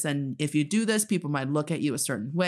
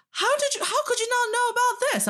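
much higher mock disbelief; incredulous people. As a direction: imitate someone shocked you don't know. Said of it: "when people are like".

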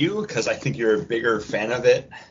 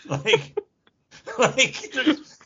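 laughing; 8 semitones higher than usual. Real, not acted. Laughing mock explanation.

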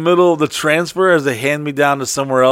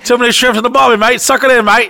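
high, quick mock Aussie heckle; raised Australian bystander voice; Australian accent; 7 semitones higher, 4 dB louder than usual. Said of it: "There's an Australian dude or a Kiwi".

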